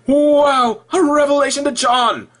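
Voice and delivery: sarcastic mock awe.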